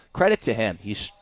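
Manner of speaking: normal talking voice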